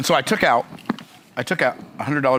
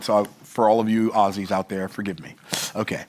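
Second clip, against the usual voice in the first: ordinary delivery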